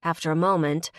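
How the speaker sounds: usual speaking voice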